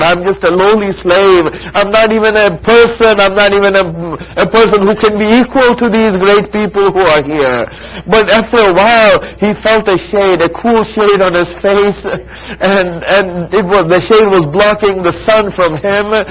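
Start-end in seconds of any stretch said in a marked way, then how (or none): 5.4-16.2 loud, high, rising majlis preaching; impassioned preaching. Acted. Preacher driving a mourning crowd to climax, impassioned. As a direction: build the grief to a peak.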